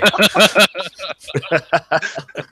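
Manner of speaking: high, sustained burst of laughter; long high cackle of amusement; laughing. Real, not acted. Teasing a flustered friend, high laughing.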